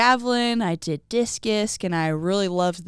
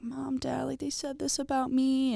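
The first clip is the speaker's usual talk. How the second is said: whispered, tearful; 7 semitones higher, 7 dB quieter than usual. Retold or imitated. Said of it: "going home and crying and being like".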